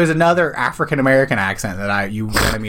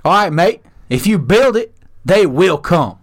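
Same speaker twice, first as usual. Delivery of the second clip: put-on Australian accent; 5 semitones higher, 4 dB louder than usual. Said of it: "doing accents game".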